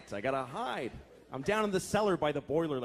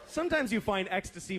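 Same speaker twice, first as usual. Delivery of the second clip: ordinary talk, nothing marked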